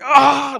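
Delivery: sudden high yelp of pain; imitating his yell of pain. Pastor reenacting for the congregation how he screamed, sharp high yelp. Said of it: "y yo grité".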